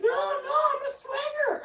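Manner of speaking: very high, loud panting; breathless neighbor. Retold or imitated. Winded neighbor catching up to him, very high and panting. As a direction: gasp it out, winded. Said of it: "She's like".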